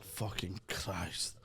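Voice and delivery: low, slow muttered curse; weary, slow mock despair. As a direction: drag it out, low and quiet. Re-enacting his own text.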